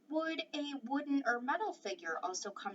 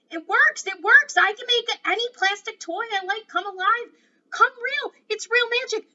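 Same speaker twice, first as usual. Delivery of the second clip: very loud and much higher, rising with joy; excited shout; 12 semitones higher, 16 dB louder than usual. Acted. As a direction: shout it out, overjoyed at the discovery. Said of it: "he shouted".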